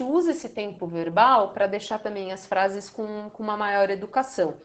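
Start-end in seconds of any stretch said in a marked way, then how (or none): none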